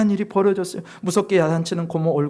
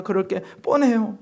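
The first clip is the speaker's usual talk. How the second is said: usual speaking voice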